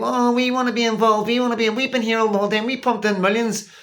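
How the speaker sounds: high, fast, whining mimicry of complaining leaders; whiny mock complaint. A vlogger mocking European leaders in a whiny high voice. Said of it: "they're all complaining about not getting round the table".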